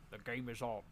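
Sean Connery line. Acted. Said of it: "and he says".

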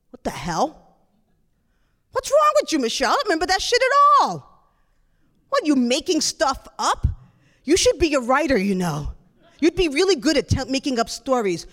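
loud, incredulous brother; acted outburst, exasperated and dismissive; scornful disbelief. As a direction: throw the apology back with mockery. Brother angrily dismisses her apology.

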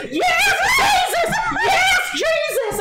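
mock revival shout, very high and slow; loud, drawn-out hammed-up religious cry; ecstatic worshipper imitation. Imitating a lady in frenzied worship.

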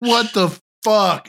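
high, drawn-out disbelief; stunned, can't believe it; shocked disbelief. Real, not acted. Shocked at the fork story.

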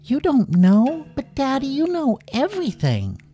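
put-on very high, incredulous kid voice; high-pitched astonishment at Daddy; shocked little child. A narrator's reading. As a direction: gasp out childlike disbelief. Child shocked at father's ignorance.

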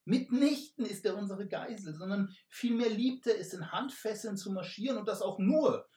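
sly smooth talk. Acted. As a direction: spin the lie with a wink. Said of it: "zwinkerte ihm zu und fuhr fort".

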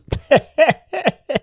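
high run of giggles; mocking laughter. Friend laughing at his mate's trouble, high giggle. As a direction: cackle at a mate's misfortune. Shows how he would have laughed.

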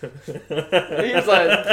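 words squeezed out through laughter, high and loud; laughing while talking. Real, not acted. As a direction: try to speak while cracking up.

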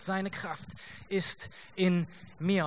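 hushed, very slow, drawn out; whispered conviction; soft slow refrain. Acted. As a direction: let the refrain settle gently, almost under the breath. Refrain repeated, slowest and softest.

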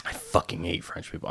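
hushed, mock-bitter joke line; deadpan fake grudge, pulled back quietly; mock hate. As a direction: fake rant kept low. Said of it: "I'm just kidding".

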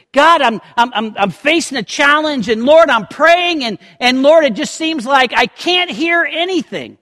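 acted desperate prayer; pleading prayer. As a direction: cry out to a distant God. Voicing a frustrated prayer.

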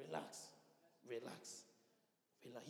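soft, settling repeated relax; calm them down gently; soothing relax. Acted. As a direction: hush the room softly. Preacher soothing restless congregation.

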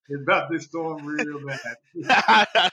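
slow words through laughter; laughing. Real, not acted. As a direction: laugh while saying it. Laughing about storm.

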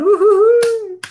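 high-pitched excited whoop. Real, not acted. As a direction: let out a high cheering whoop. Cheering a cohost's arrival.

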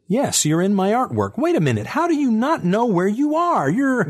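high, incredulous voice; exasperated disbelief; exasperated woman. A narrator's reading.

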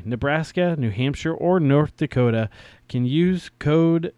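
polished, punchy sponsor read; podcast commercial voice. Acted. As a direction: sell it cleanly, hitting key phrases. Reads a sponsor ad.

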